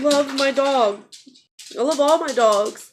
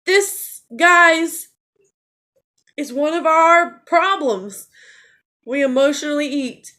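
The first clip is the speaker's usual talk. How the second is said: higher and louder, voice straining as tears come; about to cry; 6 semitones higher, 7 dB louder than usual. Real, not acted. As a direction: fight back real tears while telling viewers. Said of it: "and I'm gonna cry".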